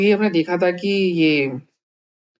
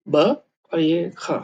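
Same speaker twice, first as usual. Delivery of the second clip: usual speaking voice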